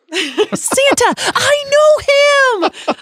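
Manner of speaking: squealing high Buddy the Elf; shrieking with elf delight, drawn out; christmas elf. Shown as a demonstration. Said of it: "what is Buddy's key phrases".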